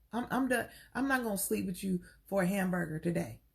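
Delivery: ordinary talk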